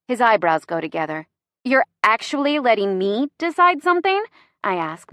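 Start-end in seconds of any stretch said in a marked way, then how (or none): 1.7-4.2 teasing. Acted. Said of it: "my voice teasing".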